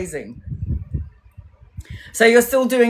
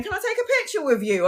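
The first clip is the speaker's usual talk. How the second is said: high, quick eager request; eager fan; 9 semitones higher, 4 dB quieter, faster than usual. Retold or imitated. Woman asking for a photo, high and eager. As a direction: ask like a shy excited fan. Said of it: "that woman's going".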